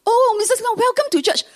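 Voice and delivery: high, gushing pastor greeting; overly nice pastor. Retold or imitated. Pastor gushingly greets the newcomer, high and sweet. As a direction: pour on the sweetness like a beaming pastor. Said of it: "Oh, the pastor was so nice".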